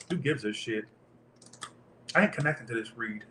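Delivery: low, soft, under the breath; muttered aside. Real, not acted. Tarot reader shrugging off the cards, muttered low. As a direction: toss it away under your breath.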